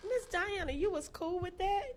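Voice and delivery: high-pitched retold disbelief; incredulous question. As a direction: imitate yourself questioning her in amazement.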